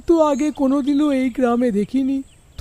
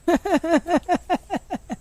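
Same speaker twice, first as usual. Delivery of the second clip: long, high laugh tailing downward; acted laugh; 4 semitones higher, 3 dB quieter than usual. Husband to his annoyed wife, acted laugh. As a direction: laugh it out in a falling run.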